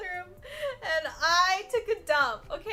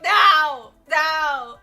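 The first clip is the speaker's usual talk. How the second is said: loud desperate yells of no; retelling the clog, yelling no; shouted no; 13 dB louder than usual. Retold or imitated. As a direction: scream no at the toilet. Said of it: "And I was like".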